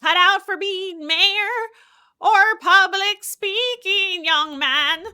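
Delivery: put-on loud, slow elderly mayor; drawn-out, booming old official.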